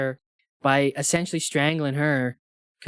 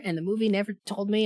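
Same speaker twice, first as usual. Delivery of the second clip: normal talking voice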